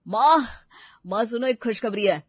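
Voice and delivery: excited calling. Acted. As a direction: burst in bursting with good news. Son runs in with good news.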